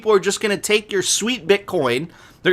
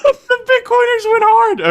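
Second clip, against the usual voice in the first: squeaky laugh-talk; high laughing squeal; 5 dB louder than usual. Real, not acted.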